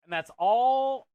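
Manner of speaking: "And that's all" drawn out slow and loud; slow emphasis. Real, not acted. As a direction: lean on "And that's all" to make the point. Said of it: "stressing the free care".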